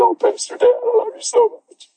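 high tender imitation of daughter; imitating a tearful woman. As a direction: mimic her loving gush. Imitating weeping woman's affection.

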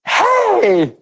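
high, loud hey across the room; high excited shout; calling out. Retold or imitated. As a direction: call out to them from the platform. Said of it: "and I went".

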